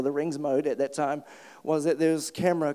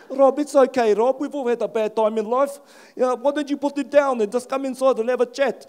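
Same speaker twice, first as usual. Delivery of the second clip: put-on loud, raised South African accent; South African cop; 6 semitones higher, 7 dB louder than usual. South African cop talks the armed man down, loud coaxing. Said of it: "He's South African".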